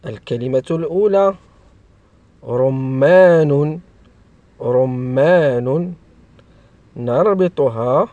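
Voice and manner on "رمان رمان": slow, drawn-out dictation of a word; slow enunciation. Shown as a demonstration. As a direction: stretch each syllable for young learners. Teacher sounds out example word. (نطق بطيء ممدود للتعليم)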